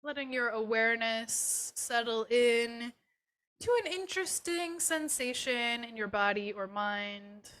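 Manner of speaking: unhurried and settling, guide them gently inward; guided meditation. Acted. Yoga teacher leading students through a body scan, guided meditation.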